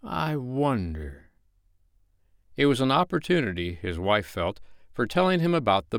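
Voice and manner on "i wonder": slow, musing repeat; lingering, pensive falling tone; thoughtful. A narrator's reading. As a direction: muse it to yourself, drawn out. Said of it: "Mister Darling said thoughtfully".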